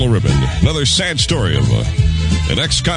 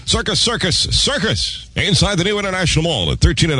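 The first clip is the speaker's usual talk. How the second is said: excited ad announcer; 7 semitones higher than usual. Acted. Ad announcer shout.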